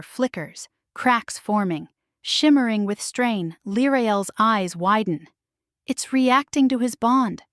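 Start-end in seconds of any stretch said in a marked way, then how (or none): none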